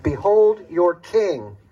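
slow, loud, ringing announcement; loud proclamation. Acted. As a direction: present him to the crowd, drawn out. Governor presents Jesus to crowd.

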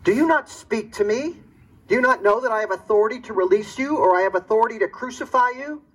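high, fast, indignant demand; a Roman governor losing patience; angry governor. Acted. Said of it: "The governor got angry".

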